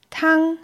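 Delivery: Tang held level and high; first tone. Shown as a demonstration. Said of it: "gleichbleibend hochgesprochen". (Tang im ersten Ton, hoch und gleichbleibend)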